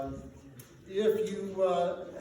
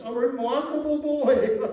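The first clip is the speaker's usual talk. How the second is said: loud, high, laughing praise; laughing delight; 8 semitones higher, 9 dB louder than usual. Acted. Scrooge praising the boy below, laughing with delight. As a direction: laugh through the words with glee.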